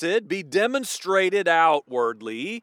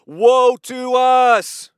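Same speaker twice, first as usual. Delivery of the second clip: drawn-out, louder cry of woe; terrified wail; 5 semitones higher, 6 dB louder, much slower than usual. Retold or imitated. Frightened Philistines crying out as God enters the camp. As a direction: wail it like doom has come. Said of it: "And they said, quote".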